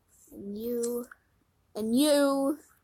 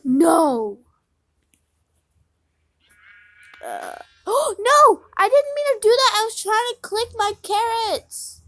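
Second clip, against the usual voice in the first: much higher, much louder panicked wail; horrified cry; 9 semitones higher, 15 dB louder than usual. Real, not acted. Farmer wailing after killing her animal by mistake. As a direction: shriek in horror at the accident. Accidentally kills farm animal.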